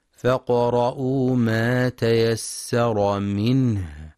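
slow, drawn-out tajweed chanting; تلاوة مجودة بطيئة; Quran recitation. Acted. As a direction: chant the verse slowly with long vowels.

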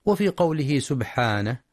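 ordinary talk

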